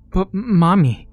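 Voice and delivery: put-on high, pleading child's voice; a scared boy begging through tears; crying little boy. A narrator's reading. Johnny cries to Mommy, high child's voice. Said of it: "Johnny cries".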